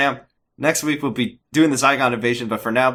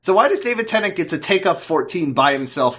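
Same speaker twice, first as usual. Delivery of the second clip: mock outrage; 8 semitones higher, 4 dB louder than usual. Real, not acted. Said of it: "I'm so mad about that".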